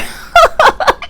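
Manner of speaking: squealing burst of laughter; high laugh. Real, not acted. Reader laughing at her own impression, high laugh.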